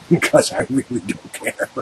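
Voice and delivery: talking through real chuckles; laughing. Real, not acted. Speaker admits to listeners they don't care, laughing. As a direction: let real laughter break into the words.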